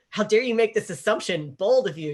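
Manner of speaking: imitated haughty outrage; mock indignation. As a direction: take offence on the character's behalf. Acting out Lark's offended reaction.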